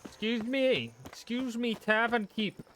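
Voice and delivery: noble knight. Acted. Addresses tavern keep in olde speech.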